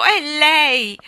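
high, very loud exclamation; cry of triumph. A narrator's reading. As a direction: shout it out in triumph. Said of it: "gettò un grido di trionfo". (grido di trionfo)

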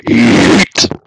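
a loud, breathy scream of "Yeet". Shown as a demonstration. Streamer screams for chat on request, loud breathy yell. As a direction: belt out "Yeet" as loud as you can. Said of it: "Say yeet as loud as you can".

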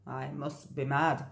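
low, soft self-mutter; low, soft resigned man; muttered grumble. Acted. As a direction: grumble to yourself. Driver grumbles.

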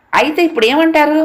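a son demands an answer from his father, impatient. A narrator's reading. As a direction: push him for an answer, fed up. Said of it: "అసహనంగా అన్నాడు రమేష్".

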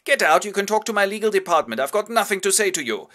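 loud, high, furious order; angry dismissal. Acted. Biedermann shouting the widow Knechtling out, loud. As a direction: throw her out with a shout.

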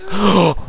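high, sudden gasp; startled gasp. Real, not acted. Player startled by the game, startled gasp. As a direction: suck in breath in shock.

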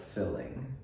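"filling" with a short i, Texas style. Shown as a demonstration. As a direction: say "filling" like a Texan, feeling as filling. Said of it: "my Texas accent just came out when I said filling".

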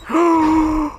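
loud, high gasp; sharp gasp of disbelief; big startled intake of breath; shocked gasp. Real, not acted. Shocked by discovery.

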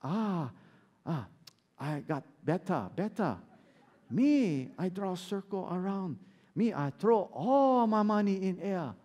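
put-on punchline told in a joking Japanese accent; a hint of a comic Japanese accent; mock Japanese accent. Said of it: "the Japanese guy, he hears that, he goes".

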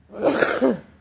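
sudden loud dry cough; real cough. Real, not acted. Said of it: "excuse me".

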